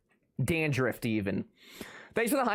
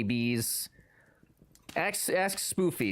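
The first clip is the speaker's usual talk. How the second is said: usual speaking voice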